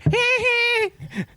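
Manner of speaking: high mocking giggle; mock him like a bratty kid; mocking kid laugh. Retelling the little girl mocking her fall, high giggle. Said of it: "like Nelson on The Simpsons".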